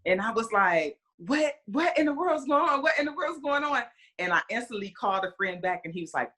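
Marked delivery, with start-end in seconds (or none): 1.2-3.8 high-pitched bewildered "what in the world"; baffled, voice jumping up high; confused alarm. Retold or imitated. Mom waking to missed calls, high, startled confusion.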